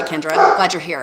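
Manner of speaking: ordinary delivery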